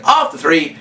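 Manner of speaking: ordinary delivery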